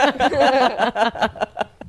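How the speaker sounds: a loud burst of real laughter. Real, not acted. Laughing at the friend's bad Irish accent, loud real laugh.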